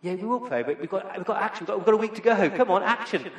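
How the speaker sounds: mock impatient student leader; eager push for action. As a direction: urge the committee on impatiently. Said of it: "And I was like".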